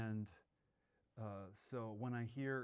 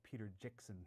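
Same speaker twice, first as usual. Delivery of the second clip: put-on Jackson said as Jickson with a short i, in a light New Zealand accent; a quick New Zealand Jickson for Jackson, lightly done; 6 dB quieter than usual. Host imitating how director says his name, light New Zealand accent. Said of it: "he always called himself".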